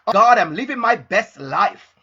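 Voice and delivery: loud, hammed-up self-satisfaction; mock boasting. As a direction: imitate someone gushing about their life. Mimics what everybody says nowadays.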